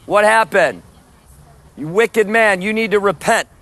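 preacher rebuking the guard; denouncing. Real, not acted. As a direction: call out the wicked man.

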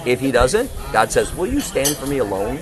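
ordinary talk, nothing marked

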